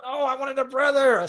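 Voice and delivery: put-on high, drawn-out childish complaint; dismayed child. Child dismayed at baby news.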